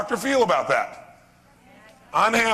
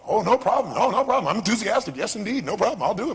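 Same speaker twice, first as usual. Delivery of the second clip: higher, eager yes-man voice; mock eager student; 4 semitones higher than usual. Daniel-san answering his teacher, mock eager and higher. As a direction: agree to everything eagerly. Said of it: "He goes".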